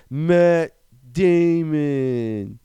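very slow, high, stretched announcement; drawn-out reveal. Pretend. Reviewer unveiling the secret cast member, in a drawn-out mock announcement. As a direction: stretch each word out grandly. Big comic reveal of the actor.

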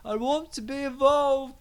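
very high, plaintive whine; Stephen Merchant. Acted. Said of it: "He always makes into our podcast".

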